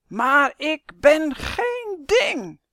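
high, loud, drawn-out shout; sudden shout. A narrator's reading. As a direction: shout it slowly, every word stretched in outrage. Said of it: "Plotseling schreeuwt hij". (langgerekt verontwaardigd schreeuwen)

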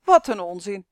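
put-on loud, dismissive burst from a boy; calling out. A narrator's reading. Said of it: "riep de jongen". (luid en smalend uitroepen)

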